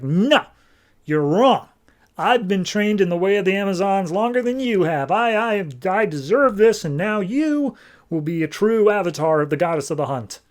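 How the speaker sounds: the villain snaps at her former friend in mock rage; angry outburst. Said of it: "Cheetah starts getting super angry".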